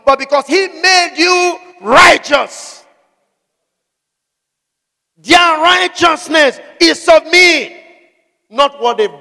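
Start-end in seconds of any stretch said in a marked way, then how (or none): 0.1-7.8 slow, loud, higher climax; preaching at full force. Acted. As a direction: build it to a thundering close.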